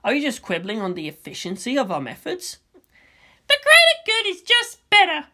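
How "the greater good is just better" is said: put-on higher, louder girl's voice for Asuka; a young girl arguing her point; Asuka's voice. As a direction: raise the voice to play the headstrong girl. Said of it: "she said with a nod".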